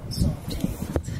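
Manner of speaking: breathy teasing whisper. Pretend. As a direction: whisper it like a taunt.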